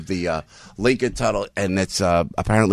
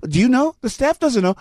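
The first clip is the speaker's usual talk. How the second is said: put-on much higher, confused old-lady voice; imitating Feinstein; 13 semitones higher than usual. Retelling Trump's rally, Feinstein baffled by the memo question, high and confused. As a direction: play a flustered, bewildered senator. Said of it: "Then he says to the staff".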